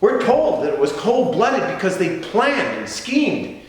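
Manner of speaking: high, indignant oratory; outraged accusation. Acted. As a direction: hurl the charge back at the prosecutors. Outraged accusation against the prosecutors.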